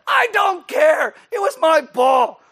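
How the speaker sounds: very high, loud, slow bratty whine; toddler tantrum. Acted. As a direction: shriek it like a toddler robbed of a toy. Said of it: "like a two-year-old".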